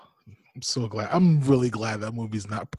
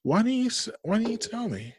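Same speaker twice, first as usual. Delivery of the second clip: disappointed dad; 10 semitones higher, 8 dB quieter than usual. Real, not acted. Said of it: "my tone was very disappointed in you".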